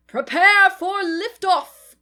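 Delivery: a little teddy captain rallying his crew, slowly; determined launch command. A narrator's reading. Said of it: "his voice filled with determination".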